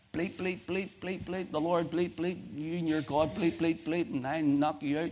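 high-pitched censored swearing tirade; mock cursing rant. Preacher imitating the man cursing him at the door, high mock rant. Retelling an angry man's swearing.

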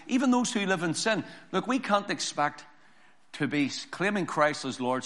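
ordinary talk

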